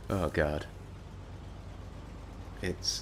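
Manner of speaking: ordinary talk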